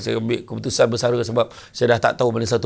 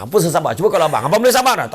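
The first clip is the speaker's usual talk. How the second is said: louder and higher toward the end; rising outburst; 5 semitones higher, 5 dB louder, much faster than usual. Acted. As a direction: snap back at husband, building. Isteri membentak suami. (isteri membentak suami dengan marah)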